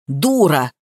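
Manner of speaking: low, abrupt rebuke; mildly barked, cutting her off; barked insult. A narrator's reading. Master insulting housemaid, understated bark. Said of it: "гавкнул он".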